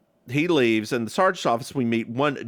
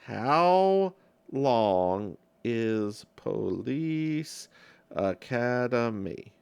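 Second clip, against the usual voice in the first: very slow, stretched word by word; drawn-out; much slower than usual. Real, not acted. Looking up a movie's runtime, slow and drawn-out. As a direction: draw each word out while looking it up.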